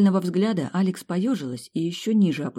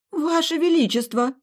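high gasping cry of shock; 12 semitones higher, 4 dB louder than usual. A narrator's reading. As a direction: gasp it out, much higher. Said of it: "охнула миссис Клавдия".

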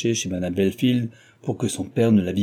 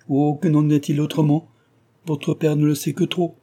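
raised, mildly anguished reply; regretful lament; 6 semitones higher than usual. A narrator's reading. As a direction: wish aloud it were otherwise. Heroine laments the truth.

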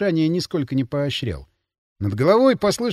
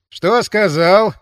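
ordinary delivery

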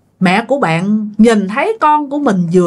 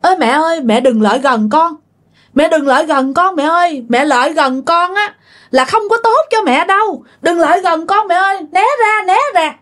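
rising higher, pleading mock-urgent warning; 7 semitones higher than usual. Dead son urging his mother to stay away, rising alarm. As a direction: push her back, voice climbing with alarm.

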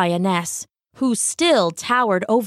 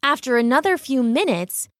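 usual speaking voice

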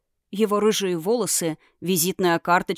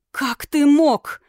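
higher, louder and very slow; furious reproach; 6 semitones higher, 6 dB louder, much slower than usual. Acted. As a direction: drag out each word in outrage. Princess confronts her kidnapper.

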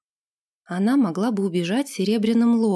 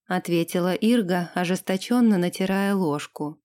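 usual speaking voice

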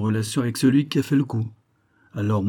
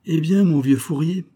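higher, thin put-on voice mimicking Anatole; thin reedy voice; 7 semitones higher, 4 dB louder than usual. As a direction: squeeze the voice thin and reedy as you play him. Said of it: "dit-il d'une voix grêle".

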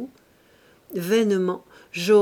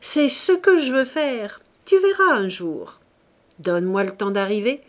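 exalté; 2 semitones higher, 6 dB louder than usual. Acted. Claude s'exalte sur sa passion.

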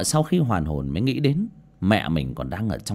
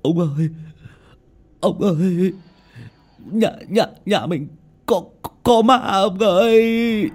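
high, stammering plea, rising louder; terrified stammer; 10 semitones higher, 4 dB louder, slower than usual. A narrator's reading. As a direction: stutter out the words in panic. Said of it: "lắp bắp".

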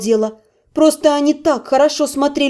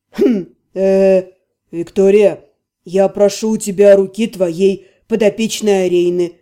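deeper, hesitant male voice; a lower voice, unsure, stumbling; hesitant low man's voice; 6 semitones lower than usual. A narrator's reading. Mage asks for her hand.

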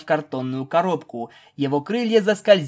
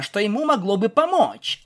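ordinary delivery

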